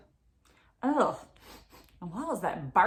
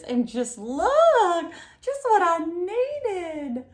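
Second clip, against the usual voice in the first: delighted; 7 semitones higher, 3 dB louder than usual. Acted. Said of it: "Mama delighted by gifts".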